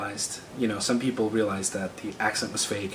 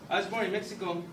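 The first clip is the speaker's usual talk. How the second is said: put-on soft, higher-pitched Mexican accent; fake Mexican accent; 7 semitones higher, 6 dB quieter, faster than usual. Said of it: "my accent is in fact fake".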